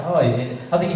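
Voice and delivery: normal talking voice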